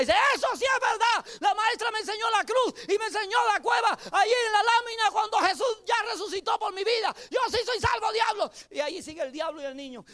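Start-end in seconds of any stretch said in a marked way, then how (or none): 0.3-8.5 put-on child shouting defiantly. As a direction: shout it back at the devil like a stubborn boy. Child shouting back at devil.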